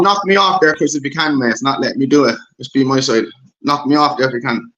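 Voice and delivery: ordinary talk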